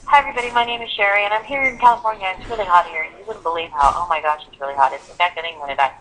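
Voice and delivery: rushed, fast self-introduction with no pauses; too fast. Shown as a demonstration. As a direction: rattle it off without giving content words time. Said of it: "if something happens so quickly".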